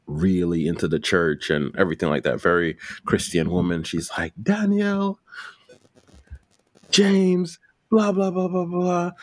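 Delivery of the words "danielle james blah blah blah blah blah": high scolding churchgoer voice; preachy cousin. Retold or imitated. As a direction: lecture them like a pious cousin.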